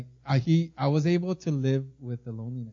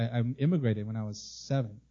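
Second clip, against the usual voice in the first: usual speaking voice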